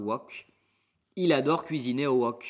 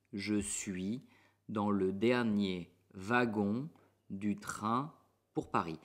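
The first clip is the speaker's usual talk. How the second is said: slow, chunked, pausing between groups; word by word; 3 semitones lower, 3 dB quieter, slower than usual. Shown as a demonstration. Teacher splits the sentence into chunks for learners. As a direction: break the sentence into small pieces.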